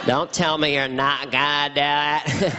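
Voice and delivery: slow, exaggerated presidential voice; drawn-out, needling presidential send-up; George Bush impression. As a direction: stretch it out like a caricatured president. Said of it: "My George Bush impression was".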